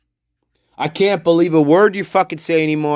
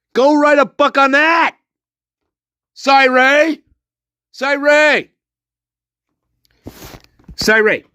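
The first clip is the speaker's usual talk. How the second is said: higher, louder taunting yell; angry yell; 9 semitones higher, 3 dB louder than usual. Real, not acted. Taunting the lawyer Cy Ray by name, a high angry yell. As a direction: shout his name up high to taunt him.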